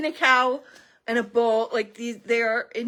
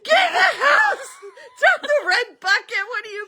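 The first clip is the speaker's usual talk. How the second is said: high, loud panicked yell with laughter; frantic yelling; 10 semitones higher, 5 dB louder than usual. Retold or imitated. Friends in the car yelling at the neighbour, loud panicked shout. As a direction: shout him back indoors, half laughing.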